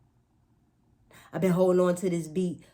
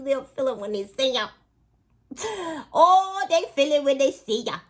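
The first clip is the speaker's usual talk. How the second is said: pitched up high and louder; gleeful emphasis; 8 semitones higher, 7 dB louder than usual. Real, not acted. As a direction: crow it, high and loud.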